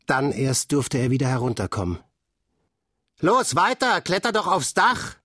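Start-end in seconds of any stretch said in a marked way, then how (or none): 3.2-5.1 urging shout from the yard; calling up. A narrator's reading. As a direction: egg him on from below. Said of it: "rief Olaf".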